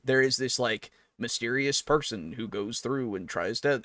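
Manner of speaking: normal talking voice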